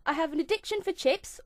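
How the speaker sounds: ordinary talk, nothing marked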